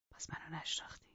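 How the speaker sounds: breathy menacing whisper. Acted. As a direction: lean in and whisper it like a threat. Heathcliff's menacing aside.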